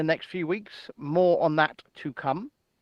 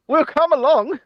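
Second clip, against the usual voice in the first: higher, louder Scottish-tinged Galloway voice; mock George Galloway; 9 semitones higher, 6 dB louder than usual. Boasting to David in a loud mock George Galloway voice. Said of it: "As him would say".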